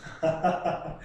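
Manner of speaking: short, soft chuckle; low quick chuckle to oneself; laugh. Real, not acted. Teacher amused by a student's comment, soft laugh.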